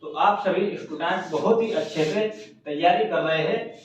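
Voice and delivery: ordinary delivery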